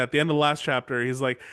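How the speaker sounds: usual speaking voice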